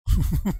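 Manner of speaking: brief, quick chuckle; short chuckle. Real, not acted. Streamer amused mid-argument, short chuckle. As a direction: let out a short laugh. Laughs during the debate.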